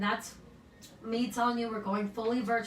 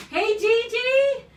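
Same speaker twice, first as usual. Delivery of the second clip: calling out; 7 semitones higher, 6 dB louder than usual. Real, not acted. Calling to a colleague.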